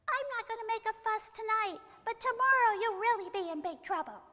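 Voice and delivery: high, loud goblin squeal; little goblin, threatening. Acted. Little goblin warns over his shoulder, high loud squeal.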